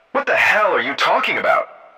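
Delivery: indignant, high-pitched comeback; sharp retort. A narrator's reading. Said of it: "Jenna retorted sharply".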